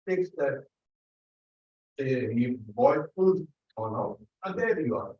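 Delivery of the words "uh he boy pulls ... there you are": ordinary talk, nothing marked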